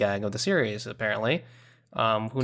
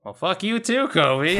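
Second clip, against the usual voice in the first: mock offended; 7 semitones higher, 5 dB louder than usual. Mock offense at harsh rating.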